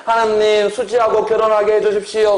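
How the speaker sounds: high-pitched mock pleading prayer; 간청하는 어조. Shown as a demonstration. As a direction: beg heaven earnestly, voice held high. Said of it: "소망 간청". (높은 목소리로 간절히 비는 기도 흉내)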